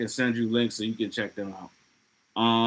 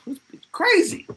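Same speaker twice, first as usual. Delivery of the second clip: much higher, squeaky mock panic; mock scared kid. Retelling the Gremlins boy fleeing, squeaky mock panic. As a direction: play the boy bolting from his crazy mom. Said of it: "He's like".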